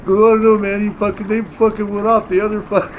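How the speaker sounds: high, loud, baffled mock teammate voice; hammed-up confused teammate. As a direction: play the lost newbie. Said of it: "They're like".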